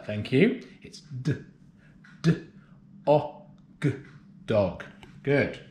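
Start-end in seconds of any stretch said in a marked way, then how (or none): 0.8-4.8 d, d, o, g apart, then Dog; sounding out words. Shown as a demonstration. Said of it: "Did I hear someone go duh".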